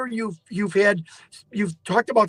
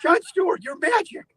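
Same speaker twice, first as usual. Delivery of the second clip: high, excited, laughing through it; thrilled; 12 semitones higher, 3 dB louder than usual. Retold or imitated. Caller reliving his phone-trick success, giddy and high. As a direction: replay the giddy moment the trick works.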